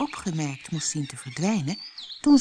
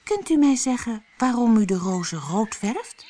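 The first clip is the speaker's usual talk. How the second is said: shy question; 6 semitones higher, 3 dB louder than usual. A narrator's reading. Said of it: "vroeg verlegen".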